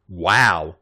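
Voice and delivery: ordinary delivery, nothing marked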